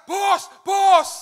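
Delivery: loud, high calling out; hammed-up cry of the wrong words; mock shout. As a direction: yell it up at the rising prophet. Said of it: "dia nggak berkata".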